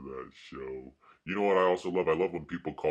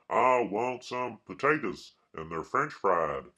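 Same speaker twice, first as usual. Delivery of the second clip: hammed-up country character impression, raised pitch; Sling Blade; 4 semitones higher, 5 dB louder than usual. Quoting a film scene about potatoes, hammed-up character impression. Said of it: "part in Sling Blade where he goes".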